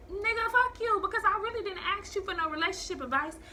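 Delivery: indignant and high-voiced; indignant retort. Retorting to officer's unwanted advice.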